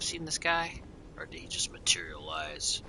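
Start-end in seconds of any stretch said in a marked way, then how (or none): 1.2-2.8 whisper. Real, not acted. Whispering while playing.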